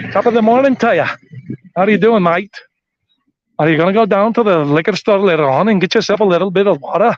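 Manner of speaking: put-on lilting Irish accent. Shown as a demonstration. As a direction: do a cheery Irish greeting accent. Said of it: "better Irish accent".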